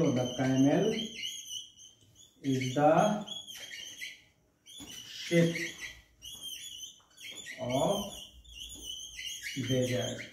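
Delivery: "The camel is the ship of desert" word by word with long pauses; slow dictation. Shown as a demonstration. Teacher dictating a homework sentence slowly to students. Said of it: "इसका figure of speech हमें आप comment भी कर सकते हो".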